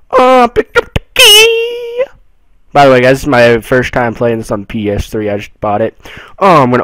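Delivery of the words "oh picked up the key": high, slow, sing-song triumph; drawn-out gleeful cheer; delighted. Real, not acted. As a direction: crow over the found key. Triumph picking up key.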